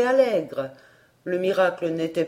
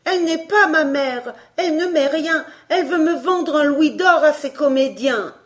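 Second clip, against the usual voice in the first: put-on higher, louder desperate child; frightened boy pleading; 7 semitones higher, 5 dB louder than usual. A narrator's reading. Emmy to the stranger, higher and louder frightened plea. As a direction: plead for rescue in fear.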